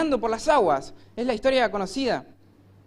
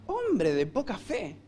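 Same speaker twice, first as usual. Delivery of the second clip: slow, softer, scornful "Hombre de poca fe"; insulting tone; 4 semitones lower, 6 dB quieter, slower than usual. Retold or imitated. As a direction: say it as a put-down. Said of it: "ahí está el insulto". (tono despectivo, lento y más suave)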